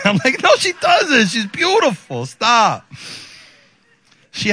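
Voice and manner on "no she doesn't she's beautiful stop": high-pitched protest; indignant defense. Retold or imitated. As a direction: protest on behalf of your baby. Defending baby's looks to wife.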